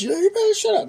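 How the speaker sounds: high, loud mock threat; imitated threat. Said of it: "stand over me with his fist".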